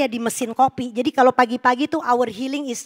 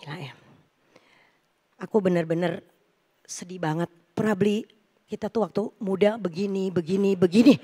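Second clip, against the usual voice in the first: sad husband; 7 semitones lower, 6 dB quieter than usual. Retold or imitated. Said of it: "Terus dia bilang gini".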